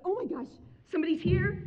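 high, loud startled dismay; mock panicked gasp of words; retold alarm. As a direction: act out being caught in a mess.

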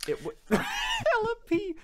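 high-pitched, laughing repeat. Real, not acted. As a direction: crack up while repeating it back. Amused at friend's slang phrase.

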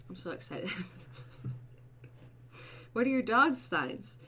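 ordinary delivery

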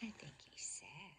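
unvoiced soft aside; whisper. Real, not acted. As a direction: breathe it quietly. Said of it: "aside whisper".

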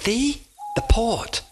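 eager, high companion; high derby voice. A narrator's reading. The derby shows Oscar the port in a high voice.

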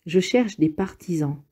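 plain reading voice

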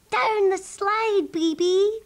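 high, loud, stretched-out call; excited shout. A narrator's reading. Sheep urge the rabbit down the slide, shouting high. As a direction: cheer a friend down a slide. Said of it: "shouted all the sheep".

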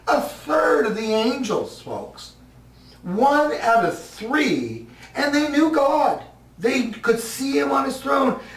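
high, slow, emphatic build; rising preaching fervor. Acted. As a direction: lift it up like a revelation.

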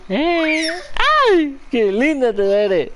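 high, drawn-out cooing praise; mimo al bebé. Real, not acted. Admiring baby in baby talk. (mimos con voz aguda y alargada)

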